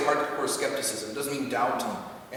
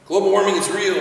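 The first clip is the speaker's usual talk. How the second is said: louder, higher blunt declaration; mock assertive claim; 6 semitones higher, 8 dB louder than usual. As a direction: declare it like settled fact. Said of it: "instead of saying".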